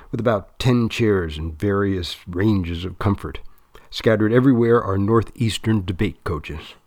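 plain reading voice

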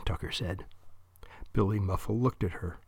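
ordinary delivery, nothing marked

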